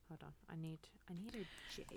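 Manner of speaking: whispered under her breath to herself; whispering while searching. Real, not acted. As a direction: mutter it to yourself in a whisper.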